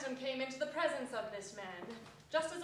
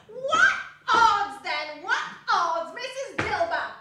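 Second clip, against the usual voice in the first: much louder, laughing, defiant; brazen charwoman; 7 semitones higher, 14 dB louder, slower than usual. Acted. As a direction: laugh it off at Mrs Dilber. Charwoman laughing at the thieves' meeting.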